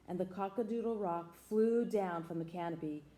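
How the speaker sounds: ordinary delivery, nothing marked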